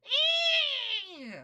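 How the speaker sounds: high-pitched falcon cry, drawn out; falcon screech. Retold or imitated. Streamer mocking a character who sounds like a falcon, high screech. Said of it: "still sounds like a falcon".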